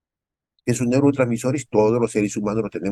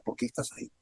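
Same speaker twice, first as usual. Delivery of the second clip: whispered question; 13 dB quieter than usual. Real, not acted. Preacher asking the depressed.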